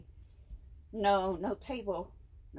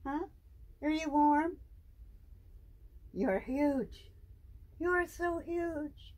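high, drawn-out cooing; 9 semitones higher, slower than usual. Real, not acted. Owner marveling at her huge python in slow, high baby talk. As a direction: stretch it out, adoring and high.

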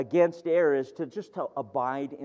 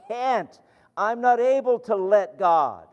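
much higher, louder frustrated cry; frustrated outburst; 8 semitones higher, 7 dB louder than usual. Retold or imitated. Young man slamming the door, frustrated and loud. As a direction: fling it out in defeat. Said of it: "slammed the door ... saying".

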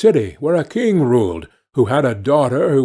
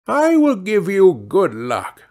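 put-on a higher, unfamiliar little old man's voice; little grey man; 5 semitones higher than usual. Little grey man promises luck to kind Dumpling, higher, kindly.

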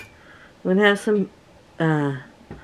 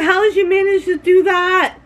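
high, astonished question, voice lifted right up; retold amazement; 11 semitones higher, 3 dB louder than usual. Retelling how she questioned the worker about her shirt, high astonished. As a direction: ask it in mock disbelief at the clumsy mistake. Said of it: "I said".

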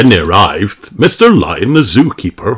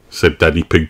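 ordinary delivery

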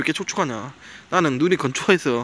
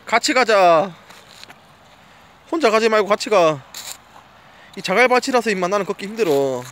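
raised voice calling the dog along; 4 semitones higher, 5 dB louder than usual. Real, not acted. Owner calling his dog on the gravel beach, loud call. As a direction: call after the dog to keep up.

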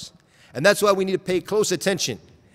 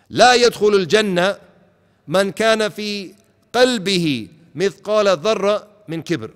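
louder, intoned Arabic quotation; hadith recitation; 4 semitones higher, 6 dB louder than usual. Shown as a demonstration. Preacher quotes the Prophet's words on arrogance, declaimed loudly. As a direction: proclaim the hadith with force.

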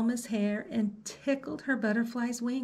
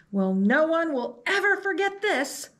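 higher, louder mock fuming; fuming principal; 7 semitones higher, 5 dB louder than usual. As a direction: huff it out indignantly. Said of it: "the principal fumed".

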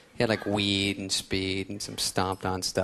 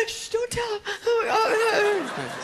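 high stoner voice; slower than usual. Acted. Acted stoner friend voice.

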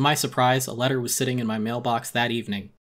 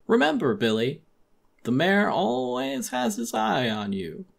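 higher, slow, sing-song warning; a hint of a sinister old mayor; creepy mayor; 8 semitones higher than usual. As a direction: menace him with false sweetness. Mayor's veiled threat.

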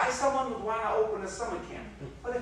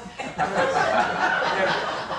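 breathy laugh; 4 semitones lower, 7 dB louder than usual. Acted.

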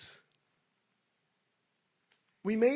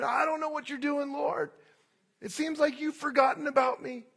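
higher, louder mock exasperation at God; exasperated; 7 semitones higher, 5 dB louder than usual. Believers complaining to God, mock exasperated outcry. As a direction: throw up your hands and complain. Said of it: "throwing up our hands and saying".

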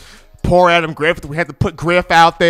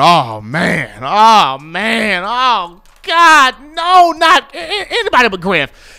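nervous fans groaning; slower than usual. Retold or imitated. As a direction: act the dread of a whole stadium. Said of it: "everybody in the stadium's going".

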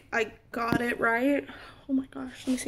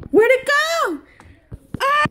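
"Where'd it go?" jumped very high and louder; frantic squeak; 13 semitones higher, 5 dB louder than usual. Real, not acted. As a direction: squeal it in real panic. Searching for the nozzle, louder.

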